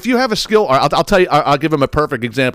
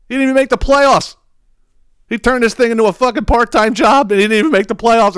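much higher and louder, jeering at him; loud mocking retort; 11 semitones higher, 3 dB louder than usual. Ribbing his brother at Christmas dinner, loud and high-pitched jeering. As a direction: crow over his wasted effort, high and loud.

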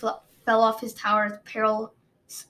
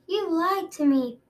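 higher, milder shrieked retake; shriek, redone; 7 semitones higher than usual. A narrator's reading. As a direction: accuse her with a high cry. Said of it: "Peril shrieked".

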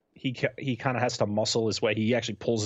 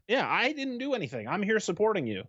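high-pitched defensive protest; put-on high voice, indignant; upset girlfriend; 9 semitones higher than usual. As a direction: imitate a wounded girlfriend arguing back. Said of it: "his girlfriend's just like".

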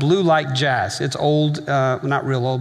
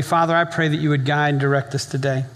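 closing prayer. Acted. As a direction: pray over the congregation with long pauses. Leads the congregation in prayer.

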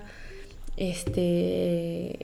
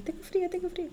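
much higher, soft shivering whine; shivering whimper; 10 semitones higher, 8 dB quieter than usual. Retold or imitated. Outside at dawn, soft shivering whine about the cold. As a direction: whimper it like you're freezing. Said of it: "Yo decía".

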